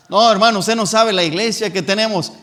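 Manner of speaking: loud, boastful mock objection; proud churchgoer. Preacher mimicking a member boasting of the church, loud. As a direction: brag about the church in a mocking voice.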